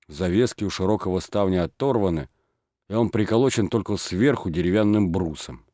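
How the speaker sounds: usual speaking voice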